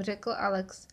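usual speaking voice

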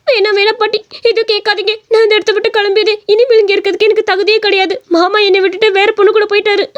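high, hurt whine to grandma; acted weepy, wronged girl; tearful complaint. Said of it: "கருவாச்சி எதுக்கு அழுதுகிட்டு கிடக்குது".

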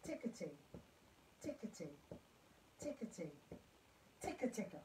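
soft, evenly spaced rhythm syllables; spoken rhythm. Shown as a demonstration. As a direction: chant tickety softly on a steady pulse. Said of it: "can say that rhythm".

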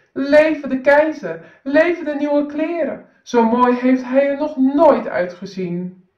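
high, loud cheers; cheering crowd. Acted. The crowd cheers the emperor, loud and high. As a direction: cheer like the crowd along the road. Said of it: "Iedereen juichte".

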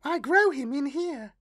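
put-on high, dreamy child voice; a strange girl sharing a secret; eerie little girl. A narrator's reading. Girl explains her imaginary friend.

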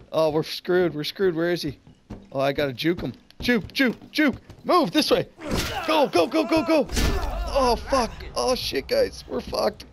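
loud, frantic panic; frantic yelling. Real, not acted. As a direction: yell while fleeing in terror. Still fleeing the killer.

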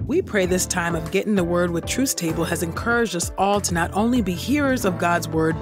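ordinary talk

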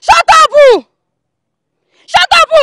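loud, sharp shout; a furious relative silencing the accused, shouting; angry shout. Acted. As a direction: cut them off with a yell. Angry confrontation over an insult.

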